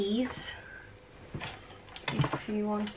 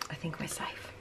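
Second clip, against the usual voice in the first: soft relieved whisper; 3 semitones lower, 12 dB quieter than usual. Real, not acted. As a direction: breathe it out in a whisper. Relief after baby stirs.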